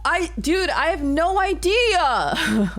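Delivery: high, drawn-out exasperated whine. Real, not acted. Streamer has no idea how to solve it, exasperated whine. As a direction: wail it high and stretch the end.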